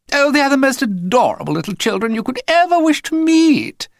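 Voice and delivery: high, loud syrupy sales pitch; gushing posh mother. A narrator's reading. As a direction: lay the sweetness on thick. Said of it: "she said to the voice".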